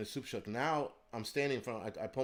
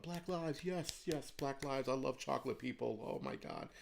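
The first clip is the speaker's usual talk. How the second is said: higher-pitched, put-on gushing voice; mock gushing liberals; 3 semitones higher, 3 dB quieter than usual. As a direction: mimic fawning applause-givers, softening at the end. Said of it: "wanna clap their hand about".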